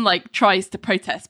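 usual speaking voice

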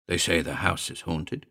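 ordinary delivery, nothing marked

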